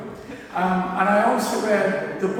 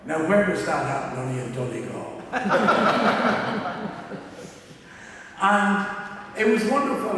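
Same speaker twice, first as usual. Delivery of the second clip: usual speaking voice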